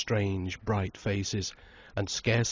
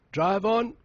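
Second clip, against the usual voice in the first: much higher, loud command; shout; 11 semitones higher, 4 dB louder than usual. A narrator's reading. Tom sending the cab away, hearty shout. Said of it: "shouted Tom Brangwen".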